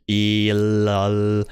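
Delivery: drawn-out, level "e" gliding into a held "l"; sounding out. Shown as a demonstration. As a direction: hold each sound on one note. Sounding out the mouth shapes.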